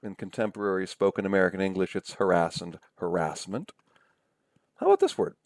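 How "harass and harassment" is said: harass and harassment as huh-RASS, huh-RASS-ment; stress on second syllable. Shown as a demonstration. Lecturer gives American usage, harass said huh-RASS. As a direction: stress RASS in both words. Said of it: "you can say either way".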